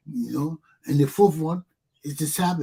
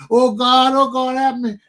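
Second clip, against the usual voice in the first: higher, louder mock cry for help; mock panicked praying, higher and louder; mock desperate plea; 9 semitones higher, 6 dB louder than usual. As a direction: beg God in mock panic. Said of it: "You say".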